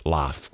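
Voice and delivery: "laugh" with a British open A, like "láf". Shown as a demonstration. Teacher contrasts the British pronunciation for students, open-A laugh. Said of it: "mais aberto mesmo".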